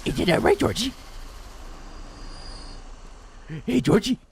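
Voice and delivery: high, sing-song creepy clown voice; Pennywise. Acted. Clown taunting little Georgie, high and sing-song. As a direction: lure the child in sweetly. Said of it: "I can do a half-decent Pennywise".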